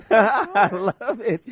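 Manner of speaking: slow words through laughter; laughing. Real, not acted. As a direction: still laughing as you speak. Laughing at the sexy voice.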